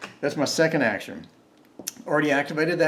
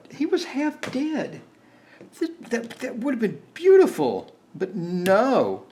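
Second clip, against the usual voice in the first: high, drawn-out lament; emotional whine; 8 semitones higher, 3 dB louder than usual. Real, not acted. Player lamenting the missed kill, high emotional whine. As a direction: wail over the near miss. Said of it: "Getting emotional here".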